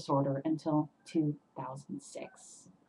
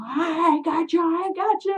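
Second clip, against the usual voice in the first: high, stretched-out holler; pitched-up call after a fleeing thief; shouting; 9 semitones higher than usual. Retold or imitated. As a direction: re-enact a taunting yell. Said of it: "shouting at him at the top of my voice".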